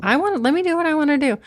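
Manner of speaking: high, fast, pleading burst; insistent protest. Retold or imitated. Woman retelling how she protested to a partner, fast and insistent. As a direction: push back quickly and urgently.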